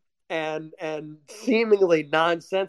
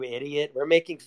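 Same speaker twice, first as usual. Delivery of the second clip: ordinary talk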